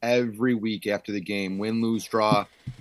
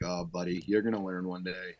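softer knowing pity; 4 semitones lower, 8 dB quieter than usual. Retold or imitated. Old coach pitying young yeller.

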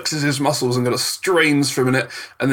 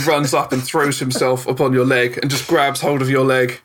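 usual speaking voice

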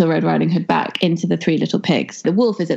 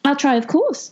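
put-on high, obliging girl voice; 8 semitones higher than usual.

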